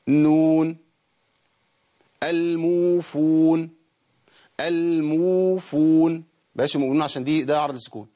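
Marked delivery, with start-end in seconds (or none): 2.2-6.2 الموفون with uu held two counts; correct waw madd. Shown as a demonstration. Teacher showing correct waw length. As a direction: draw out the uu in الموفون evenly. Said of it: "ادي واو مادية".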